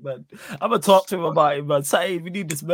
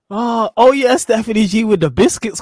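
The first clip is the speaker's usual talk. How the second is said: louder mock hype, like a match commentator; imitating a friend's excited commentary; 4 semitones higher, 8 dB louder than usual. Retelling a friend's funny comment, louder mock commentary. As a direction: belt out the line as a hyped-up caller. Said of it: "Man said".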